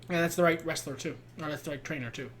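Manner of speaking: normal talking voice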